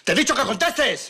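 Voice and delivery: slow, loud, high yell at the son; enraged father shouting; furious shout. Acted. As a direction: bark the order, losing patience. Father berating silent son.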